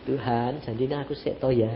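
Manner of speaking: low, soft little plea; meek imitated prayer. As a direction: murmur it to God as the wife, small and quiet. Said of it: "Dia cuma gini".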